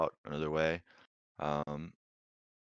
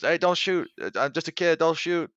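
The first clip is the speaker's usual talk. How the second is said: put-on high, loud, urgent child's plea; pleading kid; 13 semitones higher, 8 dB louder than usual. Kid facing crossbows at the manhole, high pleading shout. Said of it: "And she goes".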